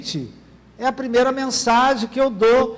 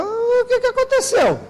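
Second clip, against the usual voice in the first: a much higher, startled squeak of a question; shocked elevator operator; 12 semitones higher than usual. Retold or imitated. Elevator operator gasping at a worker in pajamas, high startled voice. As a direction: play the stunned elevator man gaping at the outfit.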